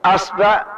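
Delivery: أصبع with a and a, asbaʕ; أصبع, open a both times, asbaʕ; asbaʕ with fatha. Shown as a demonstration. Teacher demonstrates the next fatha variant, asbaʕ. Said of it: "نأتي بفتح الهمزة".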